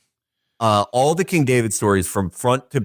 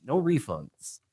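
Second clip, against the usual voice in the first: soft, understated mock-God reply; quiet comic kicker; deadpan God; 9 dB quieter than usual. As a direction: drop the volume right down for a dry refusal. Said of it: "And God goes".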